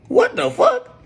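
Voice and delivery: sudden high shriek of disbelief; retold shocked outburst. Streamer reenacts seeing the gun kit with no ammo, in a shocked high shriek. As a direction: squeal it as if you can't believe it. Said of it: "I'm like".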